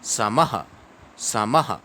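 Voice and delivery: समः with a quick ha at the end; visarga as ha. Shown as a demonstration. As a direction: say समः as sama-ha, the ha fast. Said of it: "ऐसे अभ्यास करना है".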